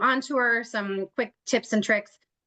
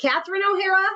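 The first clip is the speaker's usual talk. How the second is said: "Catherine O'Hara" called out louder and higher across the room; 10 semitones higher, 4 dB louder, faster than usual. Real, not acted. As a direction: call the name out to Chris in the next room.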